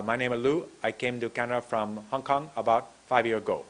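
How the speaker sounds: imitated Hong Kong accent with dropped endings; bad foreign accent. Shown as a demonstration. Teacher mimicking an accent heard sometimes from students, bad Hong Kong accent. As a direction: put on a thick Hong Kong English accent. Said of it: "maybe I sound like this. It's a very bad accent".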